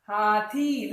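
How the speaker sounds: हाथी stretched out with a long थी; slow हाथी, थी held long. Shown as a demonstration. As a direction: draw out the ई in हाथी. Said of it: "लंबा, ई का मात्रा".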